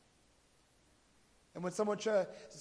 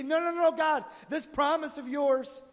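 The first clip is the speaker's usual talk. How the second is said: loud protest; 7 semitones higher, 6 dB louder than usual. Retold or imitated. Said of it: "And we say".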